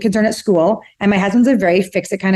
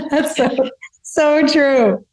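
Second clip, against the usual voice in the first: laughing agreement; 8 semitones higher, much slower than usual. Real, not acted. Laughing at partner's story.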